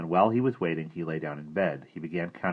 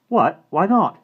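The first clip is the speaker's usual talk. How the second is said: high, loud startled question; surprised boy; 14 semitones higher, 12 dB louder, slower than usual. Acted. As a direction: blurt it in disbelief. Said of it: "boy surprised".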